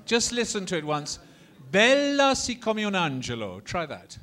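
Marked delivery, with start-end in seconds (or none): 1.7-3.6 high, ringing Italian declamation; Italian model line. Shown as a demonstration. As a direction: declaim the Italian for others to copy. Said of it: "now in Italian, let's have a go".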